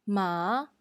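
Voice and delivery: "Ma" said low and dipping, third tone; tone three ma. Shown as a demonstration. Teacher models Mandarin third tone on ma, dipping. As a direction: drop "Ma" low like the uh-huh dip. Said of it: "examples of the words in tone three".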